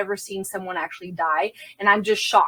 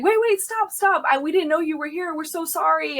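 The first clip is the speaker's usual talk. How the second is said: alarmed sergeant; 7 semitones higher than usual. Retold or imitated. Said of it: "And he's like".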